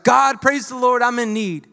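put-on loud, pleading old woman's prayer; old woman praying for groceries on her porch; bold prayer. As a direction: ask God boldly out loud. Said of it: "she was like".

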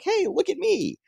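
high-pitched showing off; mock-excited, high squeal of a line; eager sidekick quote. Voicing eager Rick in retelling.

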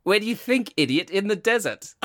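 high-pitched scornful retort; mock-angry comic punchline; Aggressive Piglet. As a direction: throw it back as a high, rude comeback. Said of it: "And he says".

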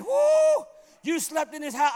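high whooped disbelief, loud; whoop, then exclaim in astonishment; impressed amazement. Retold or imitated. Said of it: "That impressed him more than anything".

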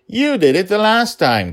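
put-on high, loud eager child; a small girl pushing to fight; little girl voice. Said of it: "Dragonlil said".

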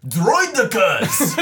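high, drawn-out alien voice; slow, comic alien impression; Phantom Menace alien. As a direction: stretch it out like a cartoonish movie alien. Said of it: "I'll do the, the aliens".

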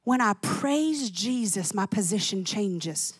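slow, soft, settled words; gentle grandma. Retold or imitated. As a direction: say it with quiet conviction. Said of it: "And she would go".

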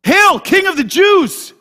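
loud, slow, sarcastic hail; mocking shout. Soldiers mocking Jesus in the fortress, loud sarcastic hail.

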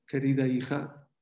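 ordinary talk

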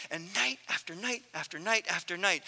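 ordinary talk, nothing marked

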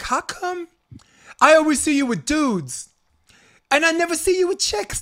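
put-on tipsy Jersey girl asking, high; Jersey accent. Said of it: "her little Jersey accent".

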